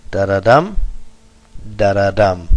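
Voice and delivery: two light beats then a heavy "dum"; anapestic stress. Shown as a demonstration. Teacher demonstrating anapests, stressing the final "dum". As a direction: land on "dum" each time, "da-da" lighter. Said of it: "two unstressed".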